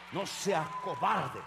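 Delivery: usual speaking voice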